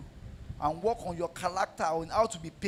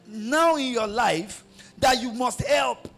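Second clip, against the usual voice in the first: high, loud, shouted sermon; fiery preaching; 7 semitones higher, 6 dB louder than usual. Acted. Pastor exhorting wives to support husbands, shouted and high.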